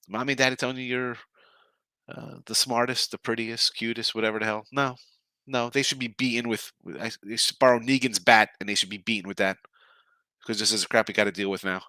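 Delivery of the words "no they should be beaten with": ordinary talk, nothing marked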